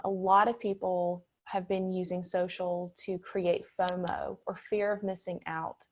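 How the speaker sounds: usual speaking voice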